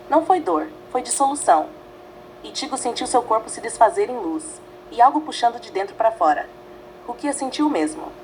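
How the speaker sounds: ordinary delivery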